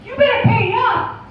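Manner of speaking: angry high cartoon falsetto; indignant, high-pitched cartoon mouse; Mickey Mouse. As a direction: scold in a squeaky mouse voice. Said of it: "Mickey wasn't too happy about it".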